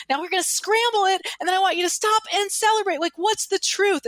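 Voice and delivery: imitating a motivational speaker, high and excited; hyped seminar leader. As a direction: whip the crowd up. Seminar leader hyping crowd.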